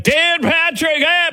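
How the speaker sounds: very high hollered name, drawn out; mock neighborhood-wide holler; imitating Tony's booming energy. Host mimics a colleague hailing him on the street, high holler. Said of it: "through the entire neighborhood".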